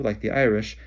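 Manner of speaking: ordinary delivery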